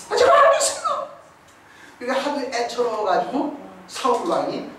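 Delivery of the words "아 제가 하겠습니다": very high, bright, laughing reply; 아주 높은 목소리로 신나서 대답하기; eager boy. Acted. David answers Saul eagerly. (다윗이 사울 왕의 물음에 신나서 대답한다)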